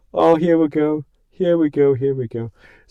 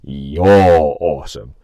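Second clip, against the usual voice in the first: lower, slow drawn-out compliment; mock-deep "You're awesome"; 8 semitones lower, much slower than usual. Shown as a demonstration. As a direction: drop low and stretch every word. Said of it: "Maybe that".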